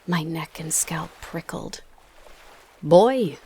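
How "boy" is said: loud sharp South African bark; loud South African summons; sharp South African accent. A narrator's reading. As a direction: snap the word out in South African. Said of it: "in his sharp South African accent".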